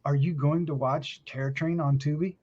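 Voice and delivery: usual speaking voice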